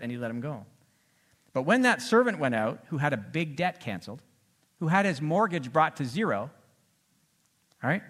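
ordinary delivery

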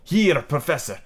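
put-on lilting, hammy foreign accent for a sea captain; theatrical captain with an unplaceable European accent; mystery foreign accent. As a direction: explain it in a wandering, vaguely French accent. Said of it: "I don't know what accent this is".

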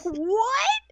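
high, laughing disbelief; incredulous. Retold or imitated. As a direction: cry out in amused astonishment. Retold own astonishment at punch.

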